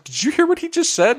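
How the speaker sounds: very high disbelieving voice; shocked hairdresser. Retold or imitated. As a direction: tell on him in shock. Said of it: "she's like".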